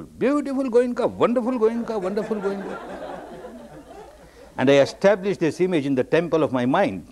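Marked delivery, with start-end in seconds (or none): 0.0-2.8 high-pitched, sing-song gushing; mock self-admiration. Man admires his carved self-image, high mock rapture.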